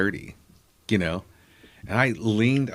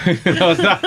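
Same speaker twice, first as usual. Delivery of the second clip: high, breathy burst of laughter into words; laughing; 10 semitones higher, 8 dB louder than usual. Real, not acted. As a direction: laugh through the start of the sentence. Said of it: "laughing at his own joke".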